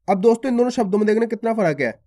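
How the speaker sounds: ordinary delivery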